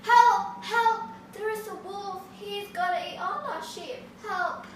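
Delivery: high, loud panicked cries for help; shouting for help. Acted. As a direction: yell for the villagers from the hilltop. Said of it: "started shouting".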